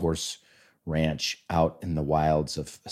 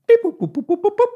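very high beeping dial tones; phone dialing beeps; 6 dB louder, faster than usual. Retold or imitated. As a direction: beep out the phone buttons, very high. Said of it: "She's using a space age phone".

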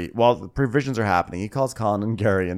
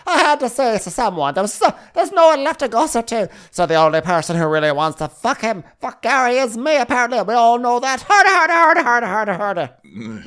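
very high, loud mock voice; hammed-up Daisy; 14 semitones higher, 6 dB louder than usual. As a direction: shriek it in a squeaky put-on voice. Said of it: "And Daisy's like".

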